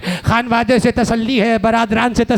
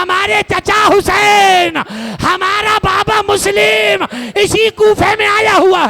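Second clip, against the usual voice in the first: anguished cry; 7 semitones higher, 3 dB louder than usual. Acted. Children cry out to woman.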